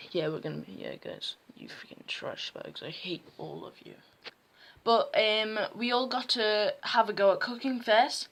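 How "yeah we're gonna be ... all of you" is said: low, hushed, hateful teacher impression; worse teacher voice. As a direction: mutter it very low as a loathing teacher. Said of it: "she had a way worse voice than that".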